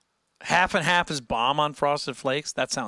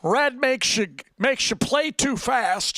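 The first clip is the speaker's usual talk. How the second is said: higher, slow and loud, a mock online guitar expert; know-it-all commentator; 8 semitones higher, 3 dB louder, slower than usual. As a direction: ham up a clueless guitar guru preaching nonsense. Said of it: "A lot of people just say".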